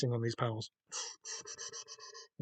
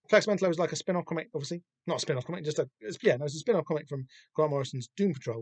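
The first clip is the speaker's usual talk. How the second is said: ordinary delivery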